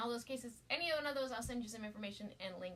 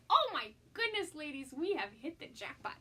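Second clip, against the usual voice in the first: excited gush; 3 semitones higher, 8 dB louder than usual. Real, not acted. Gushing over the iPad.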